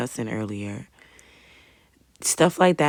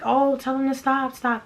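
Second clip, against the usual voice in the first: high-pitched mock scream, only a little louder; retold scream; 10 semitones higher, 3 dB louder than usual. Bystander on the train begging others to stop a fight, mock screaming. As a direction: imitate your own panicked plea, held back. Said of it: "and then I was screaming".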